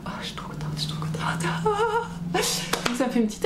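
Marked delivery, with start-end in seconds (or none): 0.1-1.2 excited little whisper. Real, not acted. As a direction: breathe it out, thrilled and hushed. Thrilled about the book signing.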